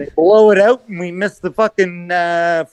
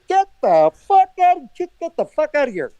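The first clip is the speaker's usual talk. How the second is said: exasperated dismissal; 7 semitones higher than usual. Acted. Acting out Keith Pelly dismissing someone, exasperated. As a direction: throw him out in mock exasperation.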